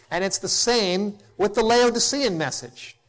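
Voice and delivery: ordinary talk, nothing marked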